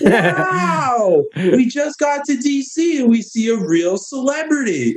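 faking awe to trap a politician; naive country bumpkin. Retold or imitated. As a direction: gush like a bumpkin spotting a star, laughing through it. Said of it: "total just country bumpkin, naive".

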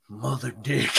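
soft, hushed drop-in to bother someone; teasing whispered line. Retold or imitated. Husband surprises wife watching TV, hushed. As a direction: sneak it in quietly. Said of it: "surprise my wife and whisper it".

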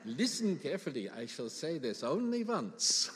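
put-on hammy French accent from a sitcom catchphrase. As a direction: say it like a comedy French Resistance fighter, in a French accent. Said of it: "say that in a French accent".

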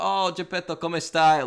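imitating an actress's Italian greeting; Italian accent. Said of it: "and she's Italian".

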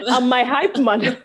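laughing through the words; amused, giggling as she says it. Real, not acted.

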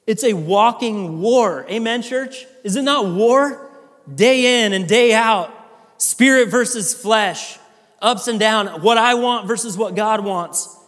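slow, emphatic preacher's build; building preaching. Acted. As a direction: drive it home to the church. Preaching at full force.

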